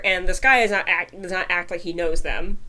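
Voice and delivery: ordinary talk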